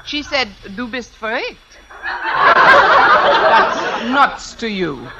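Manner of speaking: mock-German tattling; put-on German accent. Fake applicant taunting the principal, put-on German accent. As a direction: pass on the insult in a stagey German accent. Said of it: "If I can do a decent German accent".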